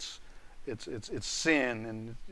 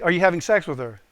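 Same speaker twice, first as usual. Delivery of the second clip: firm, slightly raised questioning; direct, louder inquiry; pointed question; 3 semitones higher, 8 dB louder than usual. Retold or imitated. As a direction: press him for an answer, retold. First asking, retold interrogation.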